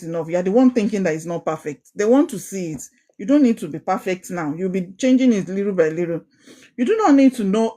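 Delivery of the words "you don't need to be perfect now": ordinary talk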